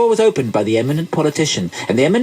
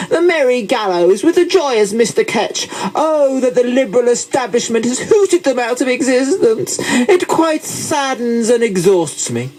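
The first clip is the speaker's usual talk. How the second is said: high, haughty character voice; pompous moralizer; 9 semitones higher, 3 dB louder than usual. Acted. Moralizer lamenting schooling of the poor, pompous and high. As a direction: lament lost discipline in a high, plummy voice.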